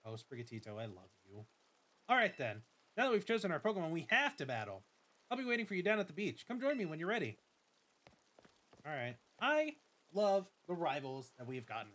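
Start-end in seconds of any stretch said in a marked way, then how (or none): 2.1-7.4 lightly voiced eager rival girl; a touch of bubbly friendliness; Nemona. Nemona meets her new neighbor, lightly eager voice.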